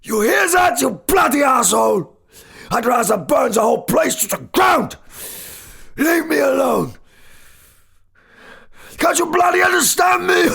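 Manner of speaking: defiant shout. Acted. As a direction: rage back up against the world. Professor rallies in defiant fury.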